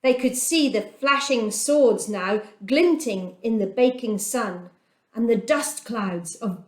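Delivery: ordinary delivery, nothing marked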